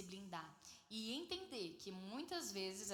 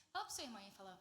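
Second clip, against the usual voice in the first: usual speaking voice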